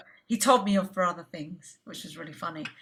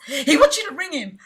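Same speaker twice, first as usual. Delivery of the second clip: excited laughing news; 7 semitones higher, 7 dB louder than usual. Retold or imitated. Friend passing on exciting news.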